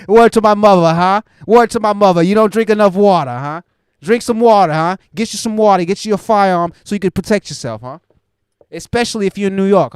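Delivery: loud, brash mock New York accent, hammed up; New York tough guy. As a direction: bark it like a swaggering New Yorker hyping himself. Mock tough New Yorker rant.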